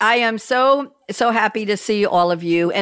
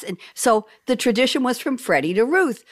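ordinary delivery

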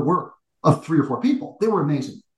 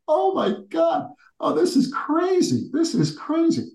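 stunned disbelief; 13 semitones higher, slower than usual. Retold or imitated. Stunned thought walking into ovation.

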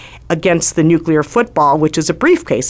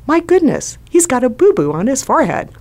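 much higher, cooing mom voice; motherly fuss; 8 semitones higher than usual. Retold or imitated. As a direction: coo it like a mom spotting a kid's scrape. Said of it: "through the eyes of a mom".

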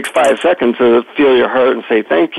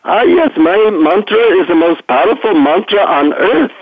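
put-on guru in an Indian accent, pitched up; deep Indian accent; 6 semitones higher than usual. Guru proudly presenting his mantra to a seeker, Indian accent. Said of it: "deep Indian accent".

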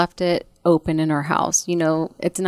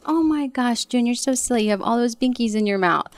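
high, sing-song mock scolding of a toddler; a mother cooing at her silly toddler; playful mom voice; 6 semitones higher than usual. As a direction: tease the baby affectionately, much higher. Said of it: "And I'm like".

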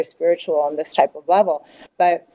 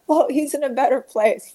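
higher-pitched mock consoling voice; mimicked platitude; 6 semitones higher than usual. Well-wishers consoling a grieving mother, mimicked.

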